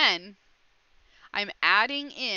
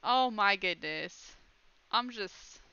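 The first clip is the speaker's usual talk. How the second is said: usual speaking voice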